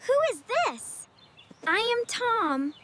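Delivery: normal talking voice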